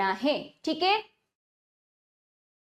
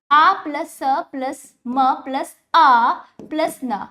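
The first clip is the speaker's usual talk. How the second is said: ordinary talk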